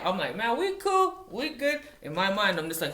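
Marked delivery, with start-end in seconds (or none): 0.4-1.8 very high, loud placating reply; mock reassurance. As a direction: act out brushing off the boss in a high voice. Retold reassurance to angry boss.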